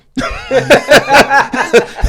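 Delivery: real laugh at the joke; big laugh. Real, not acted. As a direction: burst out laughing.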